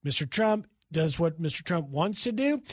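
ordinary talk

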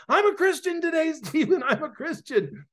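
high, loud joyful announcement with a laugh; elated, laughing exclamation, retold; overjoyed. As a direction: burst out with happy news, imitating her. Acted joyful announcement of conversion.

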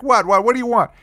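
high, quick, bothered retort; irritated answer. Retold or imitated. Rick comes to the balcony, irritated reply. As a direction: answer as if pestered, voice jumping up.

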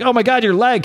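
high-pitched mock alarm; freaked out. Reader voices Kevin seeing the missing leg, freaked out. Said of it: "Kevin's all freaked out".

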